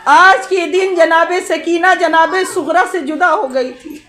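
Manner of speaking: rising, fervent preaching; impassioned majlis sermon. Real, not acted. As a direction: preach to stir the mourners. Majlis preaching at full force. (जोशीला, ऊँचे स्वर में मजलिस का बयान)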